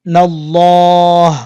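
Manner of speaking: নাল্লাহ drawn out very slowly with a full, heavy l; heavy recitation. Shown as a demonstration. Teacher demonstrating নাল্লাহ slowly for students. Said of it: "এভাবে হাটা চলে আসবে".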